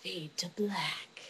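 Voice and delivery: soft, slow stage whisper; hushed whisper. Pretend. Closing the imagined film ending, slow hushed whisper. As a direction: whisper it slowly like a closing screen direction.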